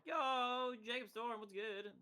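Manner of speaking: high, drawn-out "yo" shout-out; hyped greeting. Real, not acted. Streamer greeting a viewer who just arrived, hyped. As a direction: call out high to a new arrival.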